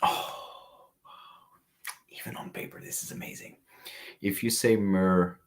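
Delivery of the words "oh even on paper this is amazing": breathy hush of delight; awed whisper. Real, not acted. Perfume reviewer to live chat, awed whisper. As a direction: whisper it like a secret treasure.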